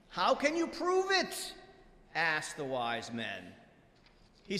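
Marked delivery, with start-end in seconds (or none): 0.1-1.5 high-pitched, insistent challenge; challenging demand. Acted. Wise men challenge the rabbi's answer, in a high demand. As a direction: demand proof, voice leaping up.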